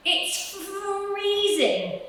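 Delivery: high, slow, drawn-out rabbit voice; shivering rabbit. Acted. Rabbit on the doorstep, high and slow with cold. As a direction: stretch it out like a frozen little rabbit. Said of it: "said one rabbit".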